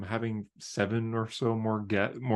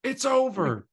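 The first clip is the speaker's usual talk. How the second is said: mock dramatic; 13 semitones higher, 4 dB louder, slower than usual. Mock announcing the end.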